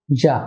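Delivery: যা said "zha" with a buzzing zh; "zha", not "za", for যা; mid-tongue zh. Shown as a demonstration. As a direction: say যা from the middle of the tongue. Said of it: "জিহ্বার মাঝ থেকে উচ্চারণ হবে".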